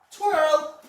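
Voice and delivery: high, loud one-word cue; dog command. Real, not acted. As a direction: call out the trick cue to your dog. Calling a trained cue to dog.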